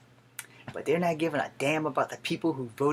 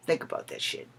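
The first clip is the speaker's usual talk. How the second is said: normal talking voice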